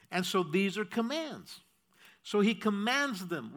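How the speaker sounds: usual speaking voice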